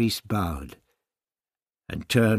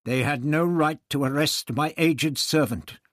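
angry; 6 semitones higher, 7 dB louder than usual. A narrator's reading. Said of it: "Barbara looked at him angrily".

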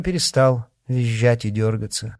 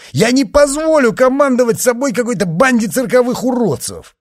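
angry shout; 11 semitones higher, 7 dB louder than usual. A narrator's reading. Gangster defies the dwarf.

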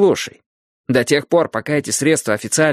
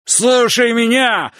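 furious slow roar, much higher; yelling; 11 semitones higher, 4 dB louder, much slower than usual. Acted. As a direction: roar it right in his face. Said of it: "проорал он мне в лицо".

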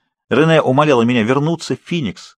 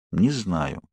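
quiet; 5 semitones lower, 7 dB quieter than usual. A narrator's reading. Edward answers Bella quietly, vulnerable. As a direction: lower and softer, almost to himself. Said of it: "тихо сказал он".

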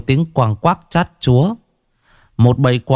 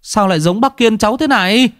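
a high, startled blurt; startled exclamation; 12 semitones higher than usual. A narrator's reading. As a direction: gasp it out in shock, much higher. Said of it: "một thằng thanh niên thốt lên". (thốt lên kinh ngạc, giọng cao vút)

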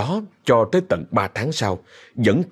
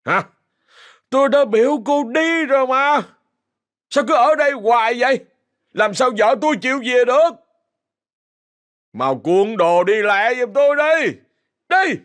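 put-on drunken angry yelling. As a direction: drive her away in a drunken rage. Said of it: "la lên trong cơn say".

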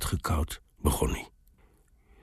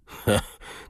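short breathy burst of laughter; 5 semitones higher than usual. A narrator's reading. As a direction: burst out laughing as the old soldier. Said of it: "begon te lachen". (korte schaterlach)

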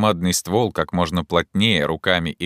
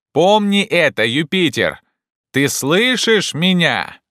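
high, loud, slow threat; scolding shout; 10 semitones higher, 5 dB louder, slower than usual. Acted. Legrand threatens Jupiter over beetle, scolding loudly. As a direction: shout a warning up the tree.

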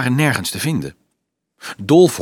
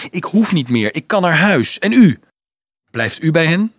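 eager; 6 semitones higher, 4 dB louder than usual. Acted. Boy eager, questioning monk.

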